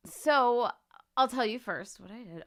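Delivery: ordinary talk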